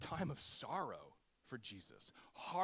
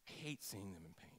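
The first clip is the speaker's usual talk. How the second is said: worried parent plea; 5 semitones lower, 7 dB quieter than usual. Retold or imitated.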